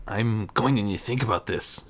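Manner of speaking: plain reading voice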